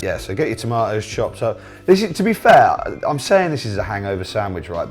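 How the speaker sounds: usual speaking voice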